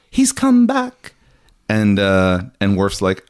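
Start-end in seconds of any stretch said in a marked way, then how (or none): 0.0-1.1 gasp, then high drawn-out awe; hammed-up amazed gasp; slow, high-pitched mock wonder; awed clerics. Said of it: "the clerics are like".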